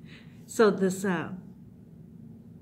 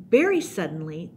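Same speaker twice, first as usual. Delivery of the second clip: usual speaking voice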